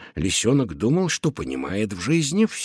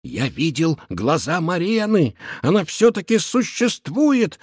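blurted out; 6 semitones higher than usual. Acted. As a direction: blurt it out, thrilled. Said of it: "выпалил он".

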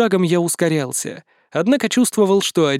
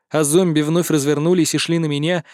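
plain reading voice

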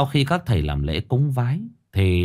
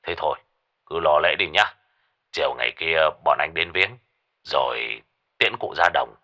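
put-on lower gruff old man; 6 semitones lower than usual. A narrator's reading. Lão Năm dặn.

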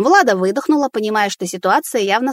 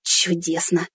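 sarcastic sigh; 3 semitones lower, 4 dB quieter, slower than usual. Acted. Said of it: "выпалила она, вздохнув".

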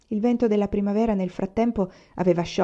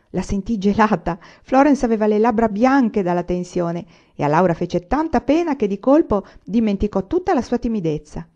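ordinary delivery

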